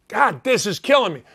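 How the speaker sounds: usual speaking voice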